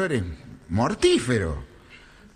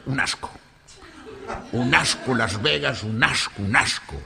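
low, grumbling disgust, in an acted Galician voice; annoyed Galician; 5 semitones lower, 3 dB quieter than usual. Galician back from Las Vegas, sourly fed up. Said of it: "Volvió muy enojado el gallego".

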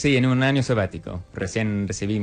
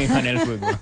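laughing; 6 semitones higher than usual. Real, not acted. Teasing the host about football.

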